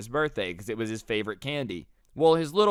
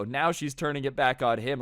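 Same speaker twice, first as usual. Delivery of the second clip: plain reading voice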